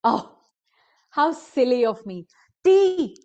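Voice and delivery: sudden high excited shout; scream. A narrator's reading. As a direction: shout out for the tea. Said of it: "she screamed".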